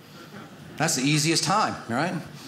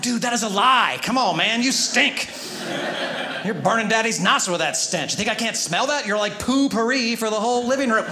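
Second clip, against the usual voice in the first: mock scolding; 6 semitones higher than usual. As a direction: ham up the disgusted dad calling out the lie. Dad scolds son about smell.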